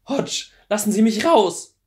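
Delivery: high, loud plea to be let out; frantic shout. Acted. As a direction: yell at him to open up. Trapped Clary begs release.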